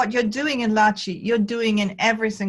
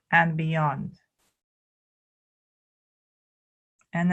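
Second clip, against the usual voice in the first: calm, measured guiding with long pauses; spacious meditation instruction; guided qigong meditation. Real, not acted. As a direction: lead them gently through each movement, unhurried.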